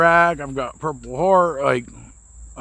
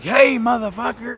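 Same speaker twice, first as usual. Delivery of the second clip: angry yell; 8 semitones higher than usual. Retold or imitated. Angry stranger confronts peeking narrator.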